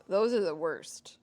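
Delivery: ordinary delivery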